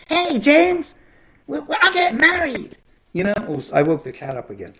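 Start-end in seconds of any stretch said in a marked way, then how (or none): 0.1-2.8 very high, loud, daft agent voice; weird CIA guy voice. Acted. CIA guy telling James he's getting married, weird high voice. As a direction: pitch it way up, loud and fake. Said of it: "You know, that weird voice".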